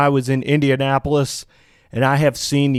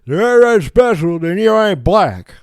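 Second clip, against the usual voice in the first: high, loud mocking impression; mock Joe Biden; 6 semitones higher, 6 dB louder than usual. Host mimics Biden telling Charlamagne off, loud mocking impression. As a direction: blurt it in a raised, distorted mimicking voice. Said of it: "telling Charlamagne on The Breakfast Club".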